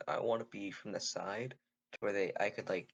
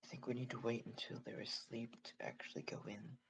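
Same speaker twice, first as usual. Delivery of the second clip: soft, airless whisper; 9 dB quieter than usual. Acted. Player urges party to wait until camp sleeps, whispering. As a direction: whisper the plan so nobody outside hears. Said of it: "trying to whisper and stuff".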